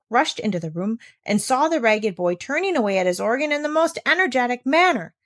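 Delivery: plain reading voice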